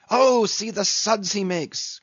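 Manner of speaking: high-pitched, frantic exclamation; excited cry. A narrator's reading. Flask watching the whale thrash, excited cry. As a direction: cry out as if dancing up and down. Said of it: "cried Flask, dancing up and down".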